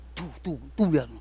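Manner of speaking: women whispering gossip about passing veiled women. Retold or imitated. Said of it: "Perempuan tuh ngelihat, langsung".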